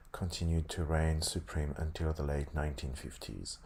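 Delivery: usual speaking voice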